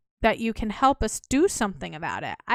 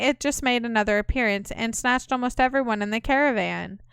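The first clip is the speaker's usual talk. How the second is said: ordinary talk, nothing marked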